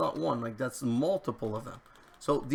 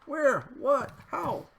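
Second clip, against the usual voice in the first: much higher baffled questions; can't believe what just happened; baffled surprise; 10 semitones higher, slower than usual. Real, not acted. Mob shot at, baffled.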